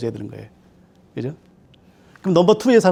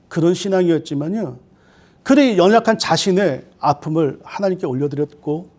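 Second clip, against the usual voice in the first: usual speaking voice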